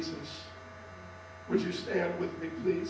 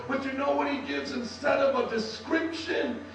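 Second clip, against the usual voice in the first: rising, louder sermon climax, slowing on the last lines; preaching at full force; 5 semitones higher, 6 dB louder than usual. Acted. Preacher calls the church to Jesus, building to a loud roar.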